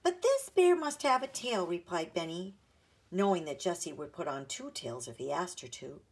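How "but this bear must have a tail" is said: put-on higher, louder little boy digging in; insistent little boy. A narrator's reading. Little brother insisting on a tail for his bear. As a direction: demand it like a stubborn small child.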